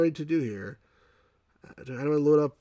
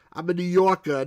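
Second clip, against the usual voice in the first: mock New York accent, louder, I'm a New Yorker punched; 4 semitones higher, 6 dB louder than usual. Shown as a demonstration. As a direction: brag it like a Brooklyn local. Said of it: "But I don't speak like that".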